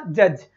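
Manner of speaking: "जज" with a quick, short middle vowel; short vowel demo. Shown as a demonstration. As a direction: keep the vowel in "जज" small, dropping in pitch. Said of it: "कैसे बोलना पड़ेगा".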